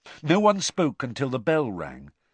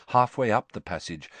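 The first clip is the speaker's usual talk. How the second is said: ordinary delivery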